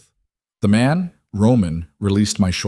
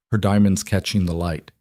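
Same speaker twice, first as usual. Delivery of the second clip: plain reading voice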